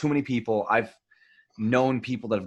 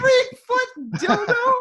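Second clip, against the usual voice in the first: much higher, slow laughing squeal; laughing disbelief; 5 dB louder, slower than usual. Real, not acted.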